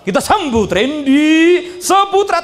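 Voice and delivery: slow, drawn-out hype-man welcome; stage MC intro. Retold or imitated. Emcee welcoming the motivator on stage, hyped and slow. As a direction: announce the star like an emcee. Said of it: "Etapa masuk pakai lagu".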